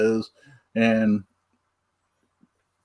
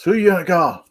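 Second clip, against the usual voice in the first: raised, punchy catchphrase call; movie trailer voice; 7 semitones higher, faster than usual. Retold or imitated. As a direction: belt the Ghostbusters line. Ghostbusters catchphrase quoted again.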